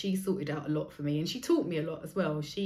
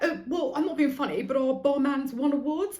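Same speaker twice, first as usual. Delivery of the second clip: loud, higher, huffy defensiveness; snooty waiter; 8 semitones higher, 4 dB louder than usual. Retold or imitated. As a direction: play the offended barman.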